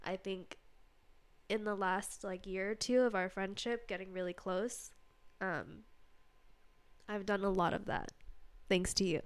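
quiet, tearful, holding back crying; softly pushing words through tears; steadying shaky voice. Real, not acted. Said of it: "'cause I'm crying".

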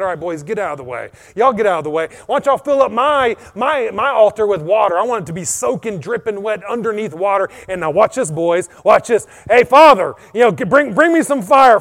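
loud command building to a high shout; bold commanding call. Acted. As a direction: take charge, then call up to heaven. Acts Elijah commanding, then calling God.

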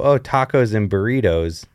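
easy native Spanish sounds on tacos and burritos; Mexican accent, natural. Retold or imitated. A son saying food words the natural way. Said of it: "I can go".

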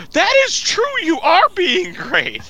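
very high mock-mom voice; gushing mom. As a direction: coo praise in a slow falsetto. Plays mom praising the boy.

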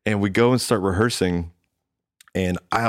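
usual speaking voice

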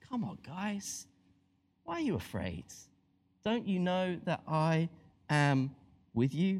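reassurance. Retold or imitated. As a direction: reassure frightened friends. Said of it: "best heard as a reassurance".

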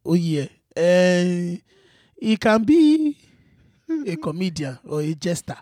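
Yoruba man giving halting advice; Yoruba accent. Pretend. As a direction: advise like a Yoruba elder, with pauses. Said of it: "mine is a Yoruba man".

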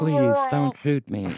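slow, loud robot voice. Pretend. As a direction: speak like a robot pleading. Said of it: "robot voice".

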